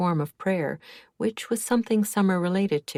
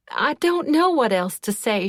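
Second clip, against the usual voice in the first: high, louder mock scream; 7 semitones higher, 5 dB louder than usual. A narrator's reading. Upset witness snapping at the group, screaming. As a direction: cry it out in distress. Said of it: "she screamed".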